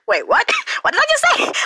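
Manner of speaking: high, fast, baffled laugh; startled, laughing at himself; confused surprise. Retold or imitated. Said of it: "I was like".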